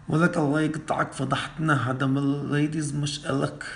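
loud, fast angry scolding; angry scolding shout. Acted. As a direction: scold him at full volume. Boss scolds him over the hijab.

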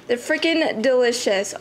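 ordinary talk, nothing marked

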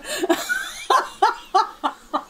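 long high squealing laugh; high helpless laugh. Real, not acted. Streamer cracking up at a viewer's build. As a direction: lose it completely, high and breathless.

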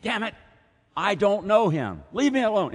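very high, loud angry yell; cursing outburst. Retold or imitated. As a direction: explode and swear him off. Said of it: "And he probably said".

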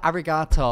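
loud British "Arigato"; English-accent arigato. Retold or imitated. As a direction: say "Arigato" the English way, mock earnest. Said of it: "I would say it in my accent".

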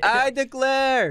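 high, loud, stretched-out shout; imitated bellowed declaration; mock scream. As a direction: belt it out, holding the last word. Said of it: "he screams".

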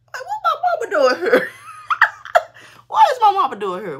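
high, loud laughing shriek; cracking up in disbelief at mama showing up. Real, not acted. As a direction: laugh it out, almost squealing.

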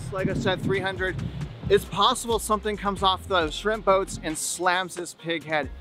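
usual speaking voice

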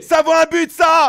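loud, high yell over the goal; goal shout. Real, not acted. Commentator celebrating the goal with a loud shout. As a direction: roar that it's a goal.

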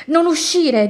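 high, loud cry of alarm; startled storybook plea; aghast warning. A narrator's reading. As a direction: warn them urgently, aghast. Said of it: "disse tutta la famiglia a bocca aperta".

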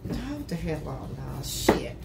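dropping to a soft, breathy curse; startled dismay. Real, not acted. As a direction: react to a mishap. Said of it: "something goes wrong".

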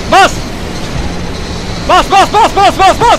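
loud, high, repeated shouts; excited urging. Real, not acted. Player urging his driver to floor it, excited shouting. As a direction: egg the driver on, punching each word.

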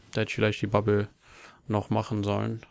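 ordinary delivery

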